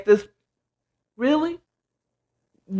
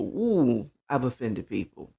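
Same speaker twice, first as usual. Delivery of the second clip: I've offended people, said much lower and much softer; hushed confession; 10 semitones lower, 10 dB quieter than usual. Shown as a demonstration. Admitting her faults to viewers, low and soft.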